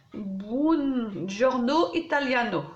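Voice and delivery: slow, drawn-out amateur Italian accent; attempted Italian accent. Shown as a demonstration. Vlogger reads a paper collection's name aloud, attempted Italian accent. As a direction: have a careful go at an Italian accent. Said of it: "attention à mon accent".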